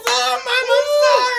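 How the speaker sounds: put-on pleading child. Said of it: "I would've been like this".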